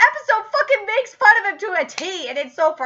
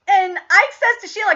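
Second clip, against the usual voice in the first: normal talking voice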